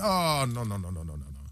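repeated no's sliding down and fading; retold refusal. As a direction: refuse in a tumbling, dying-away string. Recalling his refusal to coach.

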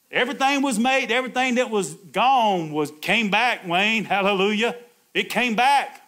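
high, loud shouted praise; rising into a loud shout of praise; full-force preaching. Real, not acted. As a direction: preach it out with fire.

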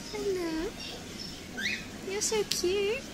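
high cooing pet talk. Real, not acted. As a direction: coo at the little hamster. Cooing at a hamster.

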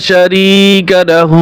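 শারীকা লাহু slowly with each long vowel held one beat; one alif lengthening. Shown as a demonstration. Teacher showing one-alif lengthening. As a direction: recite শারীকা লাহু slow, measured. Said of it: "লা এক আলিফ টান হবে".

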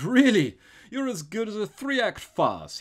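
high, loud gleeful outburst; delighted yell. A narrator's reading. Robber delighted by the priest's naive question, yelling. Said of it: "Flambeau yelled with delight".

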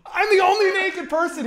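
loud, high, aggrieved outburst; mock outrage. Telling the spa story as the only naked one, loudly outraged. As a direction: blurt it out high and loud in comic indignation. Comic outrage at being singled out.

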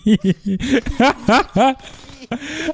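laughing. Real, not acted. Laughing at a funny kill.

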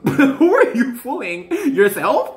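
amused, laughing rhetorical question. Real, not acted. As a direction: tease through a laugh. Laughing at pretending before Spirit.

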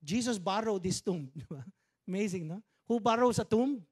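ordinary talk, nothing marked

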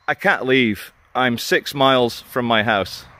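ordinary talk

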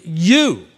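YOU pushed out loud and stretched; emphatic. Acted. Preacher voicing John's words to the believers, emphatic. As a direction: punch YOU hard. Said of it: "it's emphatic".

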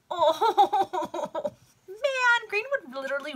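real high, loud laugh on the words; laughing disbelief. Real, not acted. Reader reacts to the villain's scheme.

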